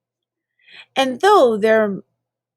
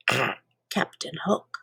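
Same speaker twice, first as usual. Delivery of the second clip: lower, softer whispered ending; 9 semitones lower, 10 dB quieter than usual. Acted. Reader closing the Captain Hook poem in a hushed whisper. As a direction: breathe the name out as a secret.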